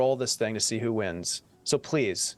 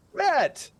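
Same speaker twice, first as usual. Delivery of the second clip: excited announcement; 14 semitones higher than usual. Real, not acted. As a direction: call the winner's name out high and bright. Announcing the giveaway winner.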